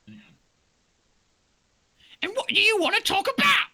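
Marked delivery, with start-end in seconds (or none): 2.2-3.7 very high, louder, silly; a shrill cartoon doe; high-pitched doe voice; squeaky deer voice.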